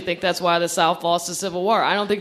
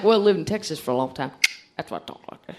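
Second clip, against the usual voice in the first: George W; 3 semitones lower, 3 dB quieter, faster than usual. Acted. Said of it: "Old George W got that accent".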